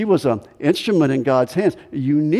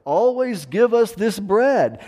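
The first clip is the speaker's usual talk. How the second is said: higher-pitched, drawn-out plea, lightly acted; lightly voiced eager crowd; 8 semitones higher, slower than usual. Crowd asking Jesus for bread, a lightly acted plea. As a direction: lightly mimic listeners asking in a raised pitch. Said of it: "they sound just like the woman at the well".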